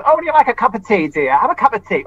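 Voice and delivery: put-on high, quick, fussing old dear; a scatty old lady offering tea; dotty absent-minded old lady. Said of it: "And here's Lily Grigson".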